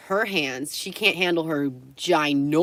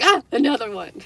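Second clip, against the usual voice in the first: higher, louder mock yelp; startled impression; 7 semitones higher, 6 dB louder than usual. Retelling how the man spotted another filmer, amused mock-startled cry. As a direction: act out his startled discovery for laughs. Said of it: "so funny how he's like".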